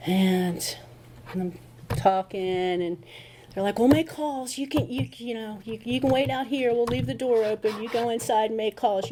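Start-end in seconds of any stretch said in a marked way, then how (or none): 3.8-9.1 reassuring staff. Retold or imitated.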